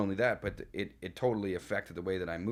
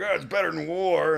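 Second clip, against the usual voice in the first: higher, louder mock of a gruff army vet; tough veteran quip; 8 semitones higher, 8 dB louder than usual. Mocking Adam's tough-guy quip about the heat, raised and loud. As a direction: toss it off like a hardened soldier. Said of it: "Adam's like".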